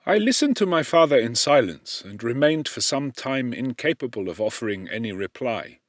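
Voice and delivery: plain reading voice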